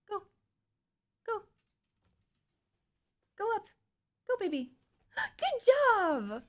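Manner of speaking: a gasp, then a high delighted good job; excited pet praise. Real, not acted. Cheering on her dog.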